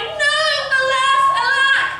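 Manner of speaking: high, loud theatrical lament; hammed-up anguished cry. A narrator's reading. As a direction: wail it high and loud like a melodrama prince. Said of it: "The prince cried".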